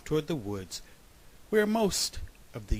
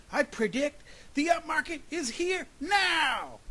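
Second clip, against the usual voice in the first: high, loud, strained cry; straining shout; 3 dB louder than usual. A narrator's reading. Groundhog still spreading the news, straining shout. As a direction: shout as if the voice is wearing out. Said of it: "kept shouting in a voice that was becoming rather hoarse".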